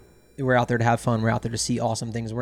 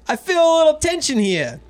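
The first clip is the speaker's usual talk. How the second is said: pushy New Yorker, pitched up high; Brooklyn accent imitation; 6 dB louder than usual. Drunk client asking the captain, Brooklyn accent. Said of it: "what that accent was".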